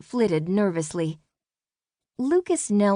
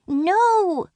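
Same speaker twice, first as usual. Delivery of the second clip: high, louder fairy cry of horror; a desperate fairy shouting no; 13 semitones higher, 5 dB louder than usual. Acted. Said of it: "cried Falsk".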